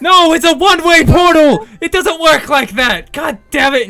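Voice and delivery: high, very loud frustrated yell; exasperated shouting. Acted. Fiona to the party about her portal, exasperated shouting. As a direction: snap back at full volume.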